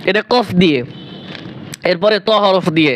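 ordinary talk

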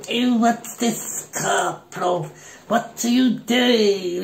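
put-on high, slow, mincing old-lady voice for the major; mock the officer as a fussy little old lady, drawn out and high; prissy old lady. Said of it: "he was like a little old lady".

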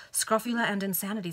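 usual speaking voice

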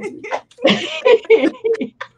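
high-pitched run of giggles; light high laughter; real giggling. Real, not acted. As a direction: keep giggling at the joke. Both laugh at the joke.

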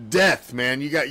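ordinary talk

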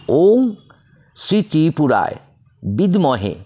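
word by word, with gaps; staccato. Shown as a demonstration. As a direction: chop it into separate pieces. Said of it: "আমি কেটে কেটে বলছি".

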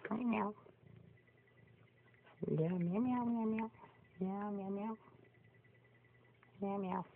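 high soft meows to a kitty; soft meowing at cat. Retold or imitated. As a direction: meow back gently in a high voice.

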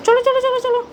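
high, loud, rapid-fire coaxing; urging along. Real, not acted. Owner hurrying the pet upstairs, urging.

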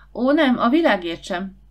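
loud, high protest; cry out. A narrator's reading. Jancsi refusing to postpone the trip, crying out. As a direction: cry out against the idea. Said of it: "kiáltott Jancsi".

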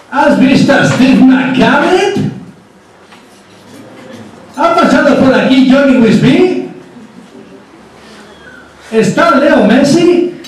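high questions with rising endings; questioning saloon villain. Acted. Bad guy questioning the saloon barman, high rising questions. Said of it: "empieza con las preguntitas".

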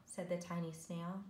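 usual speaking voice

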